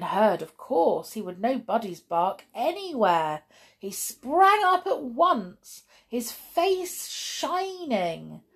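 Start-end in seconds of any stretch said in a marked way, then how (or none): none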